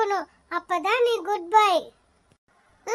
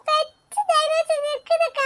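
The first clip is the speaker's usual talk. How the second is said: put-on high, slow, wide-eyed little-kid wonder; excited child; 7 semitones higher than usual. Child asking big sister about the big dinosaur, excited. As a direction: ask in a high, drawn-out child voice.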